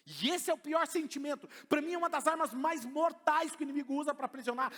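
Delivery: loud, high preaching to the church; full-force preaching. Acted. Pastor exhorting the congregation, loud and high. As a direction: preach it out with power.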